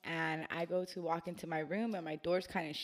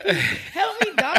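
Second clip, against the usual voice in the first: mock plea; 13 semitones higher, 13 dB louder, slower than usual.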